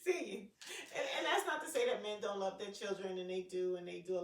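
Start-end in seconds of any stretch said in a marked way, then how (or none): 0.0-0.4 loud, laughing mock dad signing off; hammed-up breezy goodbye through laughter; carefree dad. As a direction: toss it off like a dad walking away without a care. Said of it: "He like".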